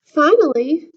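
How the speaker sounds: usual speaking voice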